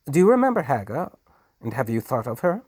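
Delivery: high, fast and pointed; taunting. Acted. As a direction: needle him quickly. Hark taunts the Duke.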